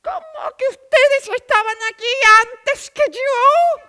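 loud, high, offended protest; outraged, raise your voice at the plant; loud indignant huff at a cheeky plant. Acted.

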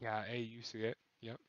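soft whispered aside; whispering. Shown as a demonstration. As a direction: whisper along with the co-caster. Joins the whispering cast.

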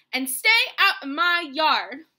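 very loud, emphatic order; angry yell. A narrator's reading. Hank banishes the jackrabbit from his yard, yelling. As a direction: shout them off your land. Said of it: "Hank yelled back".

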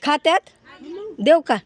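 loud, high-pitched call to auntie. Real, not acted. Vlogger asks her aunt whether goats eat carrot leaves, calling out. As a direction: raise your voice and pitch to ask across the yard.

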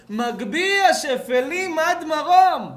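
slow, high, loud liturgical proclamation; impassioned declamation. Acted. Preacher proclaiming verse to listeners, impassioned declamation. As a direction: stretch the verse out at full force.

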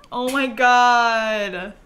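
loud, very drawn-out oh my god; overwhelmed by cuteness; gushing. Real, not acted. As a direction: stretch it out loudly, freaking out with delight. Freaking out over cute moment.

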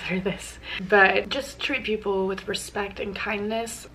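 normal talking voice

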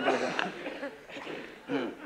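ordinary talk

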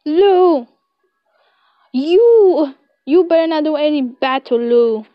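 shouting, loud and high, rushing to defend; angry shout. Acted. A friend storms in shouting to defend Lou, furious. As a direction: yell at him to keep away from Lou. Character bursts in shouting protectively.

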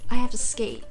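normal talking voice

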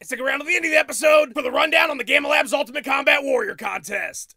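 normal talking voice

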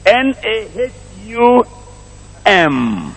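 measured spelling-out for the congregation. Shown as a demonstration. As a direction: spell it letter by letter, drawn out.

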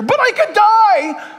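mock panicked cry. Preacher voicing fearful objection.

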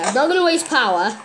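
normal talking voice

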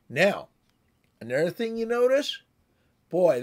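usual speaking voice

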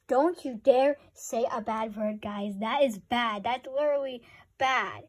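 loud, emphatic scolding; forceful don't-you-dare warning; stern warning. Real, not acted. Scolds viewers about bad words.

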